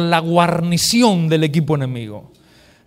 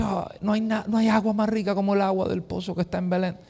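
soft longing sigh, much softer; nostalgic longing; 3 semitones higher, 7 dB quieter than usual. Acted. David longs for home water, softly longing. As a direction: ache for the well of home, softly.

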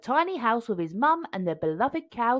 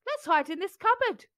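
urgent; 10 semitones higher than usual. A narrator's reading. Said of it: "cried Jack".

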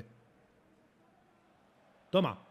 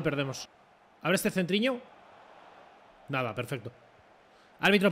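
ordinary delivery

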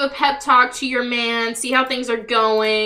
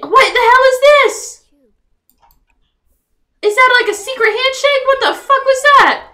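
higher, louder outraged exclaiming; incredulous yelling; 6 semitones higher, 4 dB louder than usual. Real, not acted. Streamer yelling at Red for manipulating Farquaad, incredulous. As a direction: scold the screen in disbelief.

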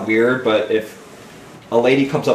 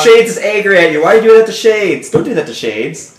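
loud, high mock-angry scolding; 10 semitones higher, 10 dB louder than usual. Defending a game character from the co-player, loud mock scolding. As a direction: tell them off on the character's behalf.

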